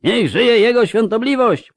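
high, loud acclaiming shout; paid hecklers' yell. A narrator's reading. Paid shouters in the crowd hail the hierarch, yelling. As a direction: yell praise like hired cheerers. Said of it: "wrzasnęło z tłumu kilku opłaconych krzykaczy".

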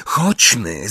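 ordinary delivery, nothing marked

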